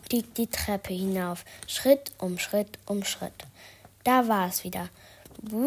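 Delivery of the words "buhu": spooky ghost booo. Acted. Ghost noise again.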